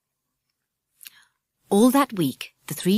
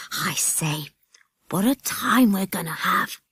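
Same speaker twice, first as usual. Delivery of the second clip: hushed, gleeful aside; grinning whisper. A narrator's reading. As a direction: whisper it with a grin of delight. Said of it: "said Jack, grinning".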